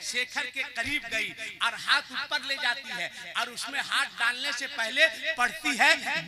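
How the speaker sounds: ordinary delivery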